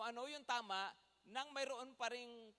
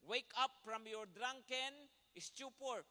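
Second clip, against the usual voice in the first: slow emphatic reading; slower than usual. Shown as a demonstration. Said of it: "tingnan nyo mabuti yun".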